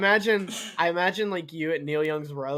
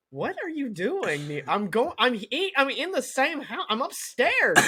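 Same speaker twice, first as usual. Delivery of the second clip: incredulous; 7 semitones higher than usual. Retold or imitated. Wife's incredulous reply.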